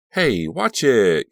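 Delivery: high, loud, drawn-out objection; loud protest. A narrator's reading. Caroline bumped in the swerving van, protesting. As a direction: yelp it in protest. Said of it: "Caroline protested to Joey".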